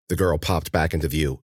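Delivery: ordinary delivery, nothing marked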